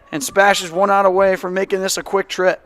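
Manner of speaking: ordinary talk, nothing marked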